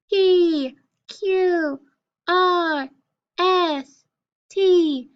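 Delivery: high-pitched letter names. Shown as a demonstration. Teacher reciting consonants for pupils, high voice. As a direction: chant each consonant high, sliding down. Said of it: "in a high voice".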